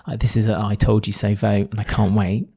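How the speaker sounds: mock-smug I told you so; imitating Jamal. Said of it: "and said".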